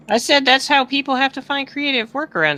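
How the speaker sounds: ordinary delivery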